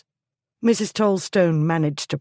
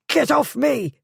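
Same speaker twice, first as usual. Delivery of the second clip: scream; 9 semitones higher than usual. A narrator's reading. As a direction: scream to push her away. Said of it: "the schoolmistress screamed".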